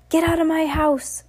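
high-pitched, indignant yell; angry order. A narrator's reading. Wife ordering the witches out, high angry cry. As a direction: throw them out, voice shooting up high.

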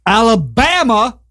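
drawn-out, high-pitched movie-quote Alabama; Forrest Gump. Retold or imitated. Host announcing the state, Forrest Gump-style drawl. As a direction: stretch it out and pitch it up. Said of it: "why I have to say it like that".

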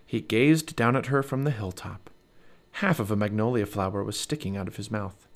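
usual speaking voice

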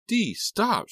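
high, loud shriek. Acted. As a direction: shriek at her to stop. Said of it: "she shrieked".

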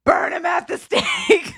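high, hammed-up cry for justice; mock outrage. Scared teen calling for the bellboy's punishment, high hammed-up cry. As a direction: play the wronged victim demanding revenge. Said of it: "And I'm also like".

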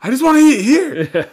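high, loud comic wail; mock wail. Mock crying over spoiled birthday.

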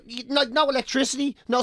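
ordinary talk, nothing marked

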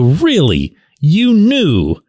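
rises high on the second question; disbelieving, voice leaping up; incredulous. Retold or imitated. Incredulous at Kenny.

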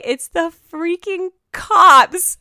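telling Susie, slow and excited; excited disbelief. Real, not acted. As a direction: stretch each word in shock.

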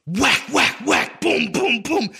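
punch sound effects. Retold or imitated. Imitating punches in story.

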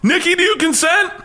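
very high squeaky put-on voice; mock polite question. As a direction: squeak it out, feigning gentlemanly courtesy. Jokingly asks Nikki mid-waxing.

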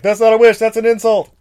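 loud, high, offended genie. Retold or imitated. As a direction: snap back like an insulted genie. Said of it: "he's like".

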